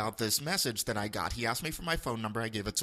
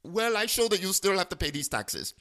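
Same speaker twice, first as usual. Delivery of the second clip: higher mock Indian-accented caller; imitated Indian accent; 5 semitones higher, 4 dB louder than usual. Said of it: "duplicate his voice and accent".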